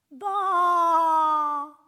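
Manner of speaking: loud, high bleating "baa"; sheep bleat. A narrator's reading. Trapped sheep calling out to its shepherd, a loud bleat. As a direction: bleat like a frightened lost sheep. Lost sheep cries from the pit.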